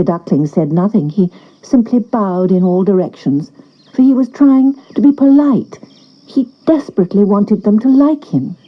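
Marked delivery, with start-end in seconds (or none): none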